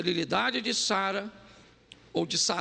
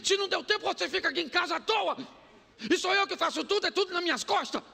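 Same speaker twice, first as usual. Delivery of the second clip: mock nagging; 10 semitones higher, much faster than usual. Staged quarrel, wife complaining.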